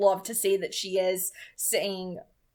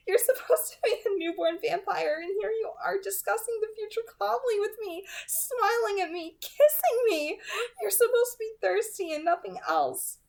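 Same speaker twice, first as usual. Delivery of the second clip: put-on much higher, playful young woman's voice; teasing Bella; 11 semitones higher than usual. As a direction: tease him with a breathless little laugh. Voicing Bella in the story.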